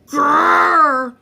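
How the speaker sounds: long playful growl; lion growl. Acted.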